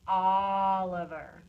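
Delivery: "Oliver" with a long, loud first O; stretched O. Shown as a demonstration. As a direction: draw out the opening vowel of "Oliver". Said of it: "let's stretch that O in each part".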